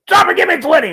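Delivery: loud high drill command; captain barking drop and give me twenty; barked order. Retold or imitated. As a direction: bark it at a recruit. Captain orders push-ups.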